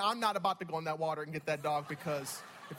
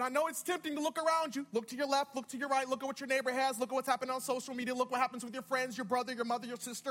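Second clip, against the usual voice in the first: raised, rhythmic sermon cadence; building, driving preaching; 7 semitones higher than usual. Real, not acted. Preacher voicing God's call to look up, driving preaching. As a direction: pile up the phrases, then land the command.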